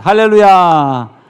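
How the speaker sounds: loud, drawn-out preacher's hallelujah; exultant hallelujah. Real, not acted. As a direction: call it out to the congregation, falling slowly.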